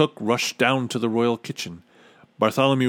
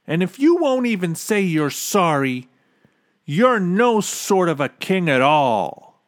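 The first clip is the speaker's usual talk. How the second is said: put-on higher-pitched, emphatic boy's scolding; defiant rebuke; 6 semitones higher, 4 dB louder than usual. A narrator's reading. Bartholomew rebukes king who won't apologize, defiant. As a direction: build to a loud, stern verdict.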